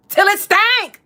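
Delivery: high, loud punchline; emphatic yell. Retold or imitated. Echoing [name]'s punchline, loud and high. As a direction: hit it high and hard. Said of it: "she said it so convincingly".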